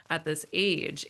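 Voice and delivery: usual speaking voice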